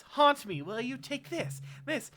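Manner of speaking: high, loud outburst while landing blows; furious attack. Acted. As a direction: lash out high and loud with each blow. Pounding the corpse in fury.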